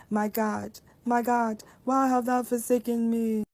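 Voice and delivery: Jesus calling to God, pleading and loud; crying out. Acted. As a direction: cry it to heaven. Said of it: "he cried out with a loud voice".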